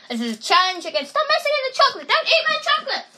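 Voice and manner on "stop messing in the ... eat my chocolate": scolding. Real, not acted. Telling someone off.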